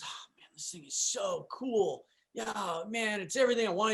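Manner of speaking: retold awestruck whisper; hushed awe. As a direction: murmur it in wonder to yourself. Retold friend admiring his truck.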